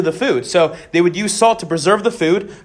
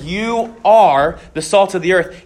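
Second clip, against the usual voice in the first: slow emphatic you are; stress on are; slower than usual. Shown as a demonstration. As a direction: lean hard on are. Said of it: "making a very emphatic statement".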